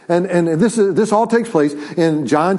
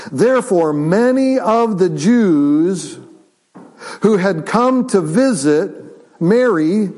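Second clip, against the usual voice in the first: scripture reading; 3 semitones higher, slower than usual. Acted. Reads scripture aloud slowly.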